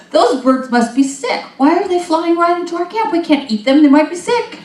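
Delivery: high, loud fretful grumbling; suspicious Israelites. Retold or imitated.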